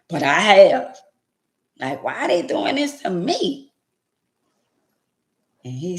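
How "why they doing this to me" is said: whiny question. Retold or imitated. Mocking own whiny complaint.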